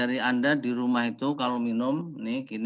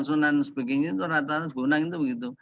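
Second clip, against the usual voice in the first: usual speaking voice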